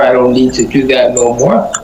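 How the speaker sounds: ordinary delivery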